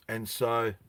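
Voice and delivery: plain reading voice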